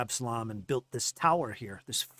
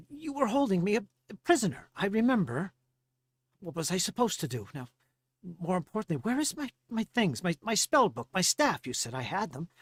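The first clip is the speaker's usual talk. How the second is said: higher, halting, agitated demand; indignant Farley; 8 semitones higher, 3 dB louder than usual. Acted. Former prisoner demanding his spell book and staff, high and agitated. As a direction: demand your things back, pitched up.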